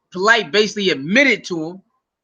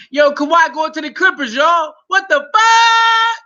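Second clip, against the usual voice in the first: higher, louder disbelieving yell; real shocked shout; 8 semitones higher, 4 dB louder than usual. Real, not acted. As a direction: scream out the shock at the news. Shocked by Kawhi trade news.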